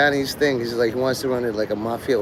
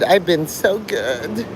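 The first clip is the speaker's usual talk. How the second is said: stretched slow "I've been so good" rising high; mock whine; 5 semitones higher, slower than usual. Mocking Mark begging his daddy for praise, slow rising whine. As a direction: drag out "I've been so good" like a pouting kid, voice rising at the end. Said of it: "ranting and raving to all".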